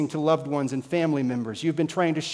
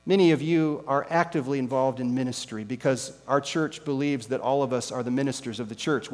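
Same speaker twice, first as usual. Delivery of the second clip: ordinary delivery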